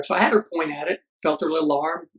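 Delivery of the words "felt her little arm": ordinary talk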